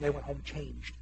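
low, soft, understated; hushed aside. Real, not acted. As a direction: say it quietly, as if confiding. Quiet first take of key line.

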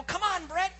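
high, urgent mock yell; retold yell. Hunter urging his partner on, mock yell. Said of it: "I'm yelling".

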